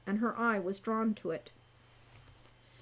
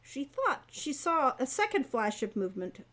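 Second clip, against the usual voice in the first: ordinary delivery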